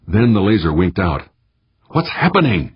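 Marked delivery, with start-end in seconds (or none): 1.9-2.7 high-pitched, understated bellow; mock bellow. A narrator's reading. Skipper to the worker as laser dies, restrained bellow. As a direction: demand it in a raised pitch. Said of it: "she bellowed".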